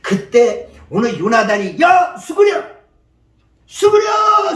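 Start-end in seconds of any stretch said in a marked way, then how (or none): 1.8-4.5 high, loud cry to take cover; urgent warning shout. Acted. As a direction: yell for a comrade to duck. Acts Jonathan shouting duck.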